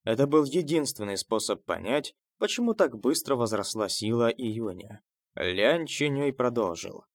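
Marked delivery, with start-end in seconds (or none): none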